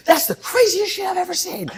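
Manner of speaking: high-pitched mock exclamation of amazement; amazed outburst. Egg-covered billionaire jumps up at the intern, mock-amazed squeal. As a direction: imitate an excited billionaire, voice leaping way up.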